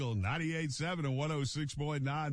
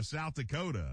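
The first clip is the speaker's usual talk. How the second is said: ordinary delivery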